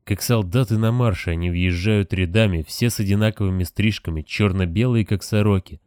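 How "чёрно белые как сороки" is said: usual speaking voice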